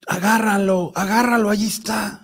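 high-pitched, urgent retold yelling; mock shouting. Storyteller voicing the brother waking at night, mock shouting. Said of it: "Despierta el hermano gritando".

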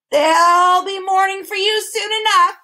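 high, loud screeching bird; cackling, shrill-pitched bird cry; hideous bird. A narrator's reading. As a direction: screech it down from above. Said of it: "a hideous cackling laugh".

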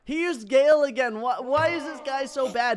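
loud, high complaint; exasperated. Real, not acted. Gamer at their failing attacks, loud exasperation.

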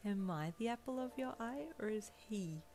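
soft, high-pitched teasing question; smirking tease. A narrator's reading. You tease Deku about his rival, smirking. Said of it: "You smirked".

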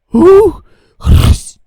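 loud, high yelp; startled shout. Acted. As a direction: cry out in shock. Attack panic. (різкий вигук)

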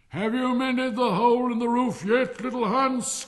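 high, booming, cheerful shout; cheery call. A narrator's reading. Miller calls to Hans on the roof, cheery shout. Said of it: "cried the miller in a cheery voice".

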